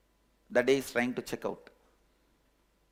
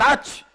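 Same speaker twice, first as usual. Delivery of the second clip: sneeze; 11 semitones higher, 10 dB louder than usual. Acted. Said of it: "he hears the sneezing sound".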